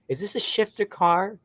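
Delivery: hammed-up entitled guy; snobby complainer. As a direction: play a spoiled brat sneering at the car. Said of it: "he's like".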